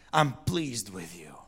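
softly, slowly, a gentle father's blessing; tender fatherly words. Retold or imitated. God tenderly telling him he is pleased. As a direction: hush it like a loving father.

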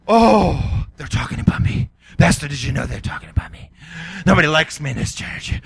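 imitating a touchy church member complaining to the pastor; paranoid bitter churchgoer. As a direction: whine that everyone's talking about you. Bitter person imagining gossip.

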